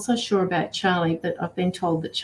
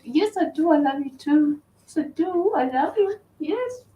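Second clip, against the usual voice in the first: doting pet talk; 9 semitones higher than usual. Real, not acted. Owner telling her dog she loves her, high cooing. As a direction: gush affection in a much higher voice.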